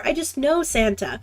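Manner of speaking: ordinary talk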